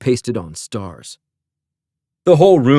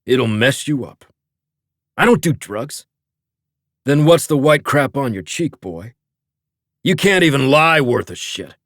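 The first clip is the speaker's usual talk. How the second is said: gruff father voice; 3 semitones higher than usual. A narrator's reading. Father Barton confronting son.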